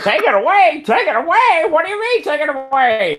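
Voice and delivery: high-pitched mock announcer exchange; imitated old broadcast. Repeating the New Year's audio to co-host, high mimicry. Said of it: "repeated as best I could what he said".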